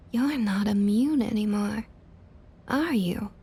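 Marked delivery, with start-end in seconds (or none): none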